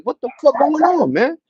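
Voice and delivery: high, loud, incredulous yelp; retold startled outcry. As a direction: act out a startled shout, pitch shooting up. Retells reaction to clowns chasing.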